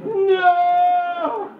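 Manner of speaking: high, stretched-out mock horror; hammed-up despairing cry, vowels held long; slow-motion wailing. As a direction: wail each word as if in movie slow motion. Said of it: "you know, in slow motion".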